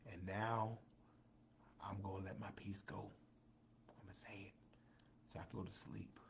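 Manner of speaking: breathless whisper, no voice at all. Acted. Host finally letting his peace go to viewers, whispered. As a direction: whisper it like a secret you've held too long.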